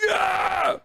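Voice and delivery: high-pitched mock yell; losing his shit. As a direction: imitate an overexcited fan cheering. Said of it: "losing his shit".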